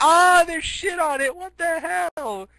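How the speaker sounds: high, loud mock yell, drawn out at the end; hammed-up alarm. Hero kicking at soiled underwear, hammed-up disgusted yell. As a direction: exclaim in high-pitched comic disgust.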